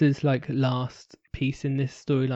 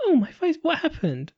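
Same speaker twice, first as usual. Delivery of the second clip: mock Sharon. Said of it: "she was like".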